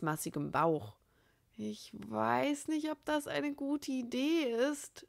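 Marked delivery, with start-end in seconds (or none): 1.6-5.1 stammering. A narrator's reading. Andy hesitating before the bully, slow stammer. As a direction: stammer it out nervously. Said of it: "stammelte Andy".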